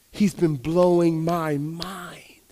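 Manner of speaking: very slow, drawn-out, voiced; slow spoken wonder after the whisper; slow awed repeat. Real, not acted. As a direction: let each word land slowly. Repeated in awe.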